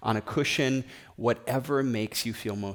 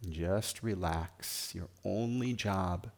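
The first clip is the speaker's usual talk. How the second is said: guided meditation; 2 semitones lower than usual. Acted. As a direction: guide them gently into stillness, phrase by phrase. Leads guided meditation and prayer.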